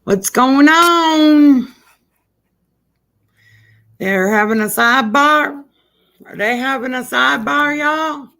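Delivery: loud put-on southern accent. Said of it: "I like my southern accent".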